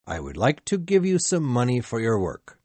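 plain reading voice

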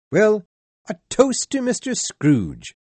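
Bob's jolly toast to the family; cheerful toast. A narrator's reading. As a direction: call out a hearty toast to the table. Bob raises a toast.

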